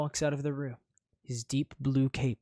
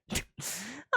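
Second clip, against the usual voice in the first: snicker; 9 semitones higher, 5 dB quieter than usual. Acted. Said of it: "You snicker".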